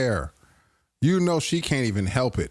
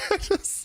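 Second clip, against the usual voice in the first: short laugh. Real, not acted. Laughing at the sound effect.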